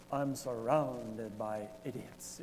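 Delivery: low, slow evil-villain impression; weary villain's line, soft and drawn out; Scar. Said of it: "wish I could do it with his accent".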